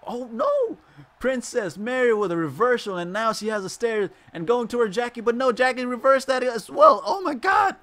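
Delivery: high, loud, rapid calling; excited play-by-play. Real, not acted. Streamer commentating the match, loud excited calling. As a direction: call the reversals at full pitch.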